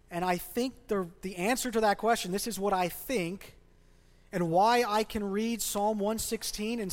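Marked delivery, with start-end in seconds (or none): none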